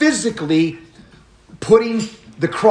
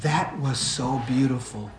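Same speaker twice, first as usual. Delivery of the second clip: soft awed voice; 6 semitones lower, 9 dB quieter than usual. Retold or imitated. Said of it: "She goes".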